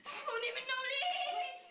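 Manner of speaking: high, loud plea for protection; alarmed shout; panicked cry for help. Acted. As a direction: cry out to be saved from him. Calls for protection during the fight.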